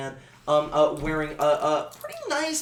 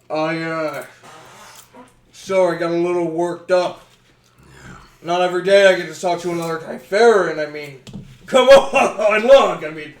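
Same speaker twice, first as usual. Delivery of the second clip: louder, eager, laughing apology; sheepish excitement; 9 dB louder than usual. Acted. Gudvold apologizing to the goblin elder, loud and giddy. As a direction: gush with excitement at meeting a kinsman.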